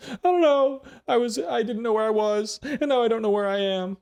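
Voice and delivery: high, loud, bewildered animal voice; confused camel voice. Acted. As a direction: squeak it out like a lost camel.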